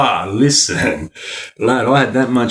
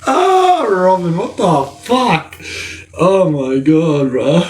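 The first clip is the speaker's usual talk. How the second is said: high, drawn-out wail from laughing; laughing to tears; 7 semitones higher, much slower than usual. Real, not acted. Streamer reacting to Robin, crying with laughter. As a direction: stretch it out, helpless with laughter. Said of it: "Full crying".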